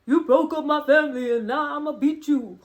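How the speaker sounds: high, loud mock threat; hammed-up vengeful brother; angry family speech. Jimmy threatening Sammy, high, loud and hammed up. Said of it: "Jimmy's giving his".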